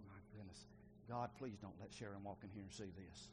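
soft, low, private plea; retold choked up. Father praying his wife won't see him, softly choked up. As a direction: quietly pray it, a touch choked up. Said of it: "I got all choked up".

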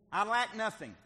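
high, loud, preached proclamation; exultant declaration. Acted. Preacher voicing David's contentment to the congregation, exultant. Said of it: "I think David is saying".

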